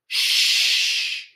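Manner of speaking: long, drawn-out shush; hushing shh. Acted. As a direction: shush a noisy person firmly. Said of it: "be quiet".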